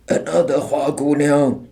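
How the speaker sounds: rough, hoarse shout; hoarse call. A narrator's reading. A soldier calls out hoarsely, gloating. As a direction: bark it out hoarsely. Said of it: "one of the soldiers called out hoarsely".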